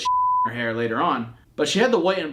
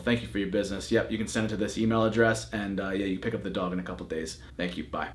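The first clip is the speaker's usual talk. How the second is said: brisk seller; 6 semitones lower, 5 dB quieter, faster than usual. Retold or imitated. Seller's quick businesslike reply retold.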